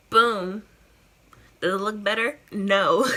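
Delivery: loud, laughing outburst. Real, not acted. Loud reveal then laugh.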